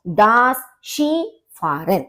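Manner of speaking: शीफारन drawn out slowly, "shee-faa-ren"; slow careful demo. Shown as a demonstration. Teacher repeats tricky word for students to remember. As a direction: say दास शीफारन slowly and clearly. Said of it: "तो शीफारन ये ध्यान रखिए".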